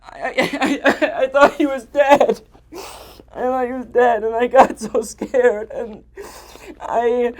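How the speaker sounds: high, very loud, stammering cries; panicked anguish. Acted. Man reliving finding her seemingly dead, panicked anguish. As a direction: break into distraught, gasping shouts.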